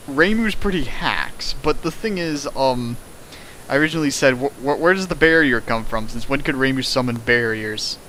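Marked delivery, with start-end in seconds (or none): none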